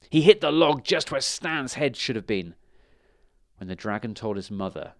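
ordinary delivery

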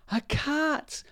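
very high mouse squeal; frightened little mouse spotting danger; alarmed cry. A narrator's reading. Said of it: "cried the Country Mouse".

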